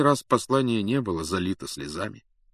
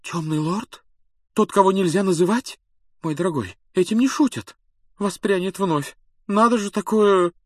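alarmed; 10 semitones higher, 4 dB louder than usual. A narrator's reading. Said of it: "Профессор Трелони, похоже, сильно испугалась".

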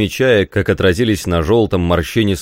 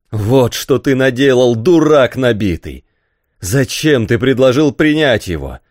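higher-pitched angry scolding; 6 semitones higher, 4 dB louder than usual. A narrator's reading. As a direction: berate the servant in anger. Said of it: "Рафаэль побледнел от гнева". (гневно отчитывает)

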